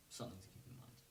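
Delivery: soft unvoiced whisper; whispered aside. Real, not acted. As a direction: drop to a whisper for the reminder. Repeats the advice.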